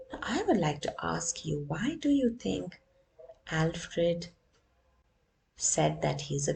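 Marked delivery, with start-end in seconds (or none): none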